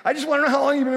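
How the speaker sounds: high laughing what; host reacts in disbelief; mock surprise. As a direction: squeak it out in disbelief. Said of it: "and I said".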